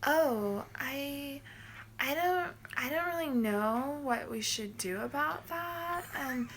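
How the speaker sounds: hesitant mock receptionist, hemming; clueless receptionist impression. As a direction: play her lost for words. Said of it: "She was like".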